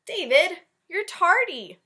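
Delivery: high, loud teacher's reprimand; scolding teacher. Acted. Teacher catching David late, high and loud scolding. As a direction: scold the late boy sharply.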